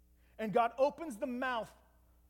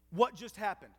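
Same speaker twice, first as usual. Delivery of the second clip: normal talking voice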